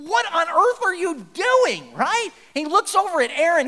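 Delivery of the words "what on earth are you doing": high, loud, slow outraged demand; outraged Moses. Acted. Moses catching the people at the golden cow, outraged. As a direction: stretch it out in disbelief. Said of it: "And he's like".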